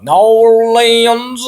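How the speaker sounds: "New Orleans" slurred into a loud, drawn-out "Nawr-leenz"; referee's botched pronunciation. Retold or imitated. Fan mocking the referee who couldn't pronounce it, "New Orleans" drawn out and garbled. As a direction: mangle "New Orleans", stretched loud and high. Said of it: "I swear that's how he talked".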